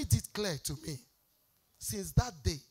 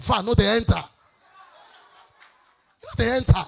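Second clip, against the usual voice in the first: loud preacher cadence, driving the point home; preaching at full force; 9 dB louder than usual. Acted. Pastor exhorting married couples, loud preaching. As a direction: preach it to the congregation.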